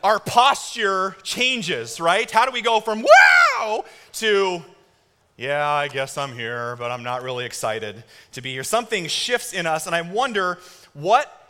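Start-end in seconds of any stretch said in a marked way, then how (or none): 3.0-3.8 put-on high, loud kid's wow; excited kid. 5.4-8.6 bored student. Retold or imitated.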